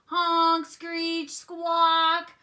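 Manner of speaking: high, loud honking words; saxophone noises. A narrator's reading. Froggy blowing his saxophone, loud high blasts. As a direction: blare them like a squawking sax.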